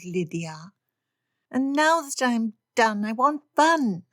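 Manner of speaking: put-on high petulant girl voice; girlish whine. Lydia complains to Papa about school, high whine. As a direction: sulk at the unfairness.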